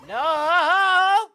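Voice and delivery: high, drawn-out shout of no; long loud "no" wail. Acted. Player refusing the offered milk, long loud wail. Reacts to game's shock.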